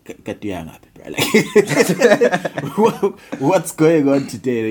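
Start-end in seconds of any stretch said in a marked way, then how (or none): 1.1-4.7 teasing through laughter, slow; a sibling mocking your outfit, laughing; laughing mock tease.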